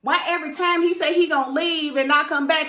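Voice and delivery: normal talking voice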